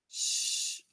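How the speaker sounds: Sh as a breathy hiss with no voice; just push air for Sh; unvoiced sh sound. Shown as a demonstration. Teacher sounding out letters while writing the word. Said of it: "syllable break".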